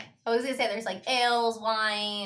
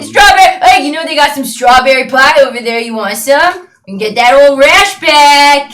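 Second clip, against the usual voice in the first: taunting bully; 5 semitones higher, 16 dB louder than usual. Acted. Bully keeps teasing the party.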